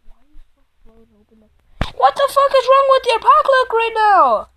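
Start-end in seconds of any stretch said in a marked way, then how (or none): 1.9-4.5 high, loud frustrated yell; furious shout. Real, not acted. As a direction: rage at the unfair luck. Raging at the opponent's pack luck.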